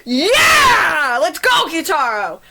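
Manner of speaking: high, loud victory shout; a big hammed-up hype yell; triumphant cheer. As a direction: cheer for the rescue at full voice. Cheering the character's rescue.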